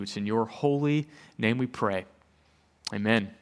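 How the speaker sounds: low, subdued pastoral prayer. Real, not acted. Pastor praying for unity before the sermon, low reverent prayer. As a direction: pray quietly and reverently, pausing between requests.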